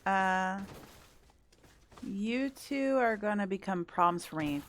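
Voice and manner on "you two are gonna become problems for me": ordinary delivery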